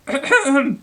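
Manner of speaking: high, loud nervous throat clearing; squeaky throat clear. Acted. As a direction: clear the throat to get attention. Mouse clears throat again.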